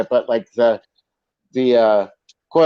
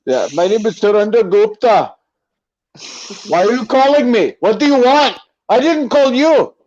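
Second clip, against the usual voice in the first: high, loud put-on Indian accent; mock Indian accent; 7 semitones higher, 3 dB louder than usual. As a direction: play a flustered Indian scammer, pitched way up. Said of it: "we're using our made up names".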